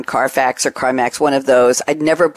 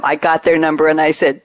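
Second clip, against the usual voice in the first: usual speaking voice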